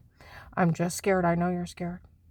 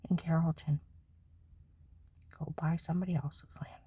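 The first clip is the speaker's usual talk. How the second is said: hissed whisper, much softer; 2 semitones lower, 8 dB quieter than usual. Real, not acted. Wife telling the land grabbers to go elsewhere, whispering. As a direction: drop to a bitter whisper.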